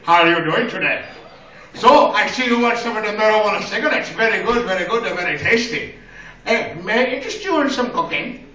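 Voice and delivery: mock accented shop clerk's sales pitch; convenience store clerk. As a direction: play a polite, eager clerk with a put-on accent. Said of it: "walk into a seven eleven here".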